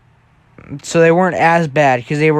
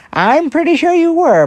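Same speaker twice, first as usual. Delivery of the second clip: very high, put-on teasing voice; 14 semitones higher, 4 dB louder than usual. Brother walks in and backs the prankster, teasing. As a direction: chime in high to rib him. Kitsuki teases Bakugo in high voice.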